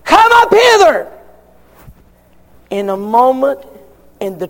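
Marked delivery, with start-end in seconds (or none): 0.0-1.1 high, loud call from above; voice of Jesus calling. Acted. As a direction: call them up to heaven, ringing high. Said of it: "Jesus said".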